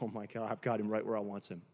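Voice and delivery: low, fast, quiet sneering villain aside; the enemy gloating over a believer, low and fast. Retold or imitated. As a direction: gloat under your breath like the devil who has won. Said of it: "the enemy is like".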